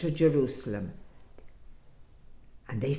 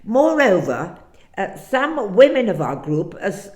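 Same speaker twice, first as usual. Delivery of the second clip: higher, louder, eager and urgent storyteller; a disciple pouring out the whole story, pitch raised; excited disciple; 3 semitones higher, 4 dB louder than usual. Acted. Cleopas tells the stranger what happened, raised and urgent.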